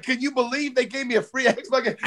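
put-on high, amazed kid voice; thrilled little son. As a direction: sound like a boy who can't believe his luck. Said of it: "he's like".

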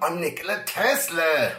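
high, swaggering gangster voice; gangster Tesla. Retold or imitated. Host voicing Tesla threatening the hotel clerk, gangster swagger.

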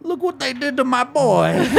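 hammed-up Godfather line with a laugh; mock Godfather. Podcaster mocking a player as the Godfather. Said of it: "I'm not sure what accent that ended up being".

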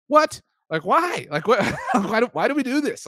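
high incredulous what; baffled outrage, laughing at the end; disbelief. Real, not acted.

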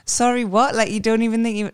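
incredulous. Retold or imitated. Incredulous reply to rival fans.

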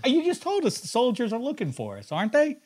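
hammy annoyed son; scolding son. Acted. Mock scolding the dying father.

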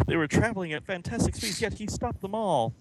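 ordinary talk

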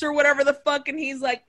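normal talking voice

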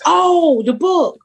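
high "oh" as it dawns; sudden realization. Retold or imitated. Author realizing they mean her book, high-pitched recognition. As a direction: act out the penny dropping, pitch lifted. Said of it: "And I was like".

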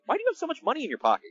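high, fast, amazed asking; mock shocked coworkers quizzing him; mock incredulous question. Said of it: "they were like".

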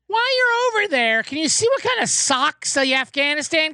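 put-on high, cranky old-man whine; mock Andy Rooney. Mimicking Andy Rooney asking about kids' socks, a high cranky old-man whine. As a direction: poke fun at a fussy old writer.

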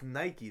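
"Nike" said NY-kee; two-syllable pronunciation. Shown as a demonstration. Co-host corrects the Brit, NY-kee "Nike". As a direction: sound the final e of "Nike" as ee. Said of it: "There's an accent".

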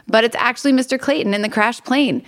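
normal talking voice

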